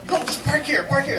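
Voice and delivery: high-pitched imitation of impatient orders; imitated bossy urging; impatient insistence. Retold colleague insisting on parking.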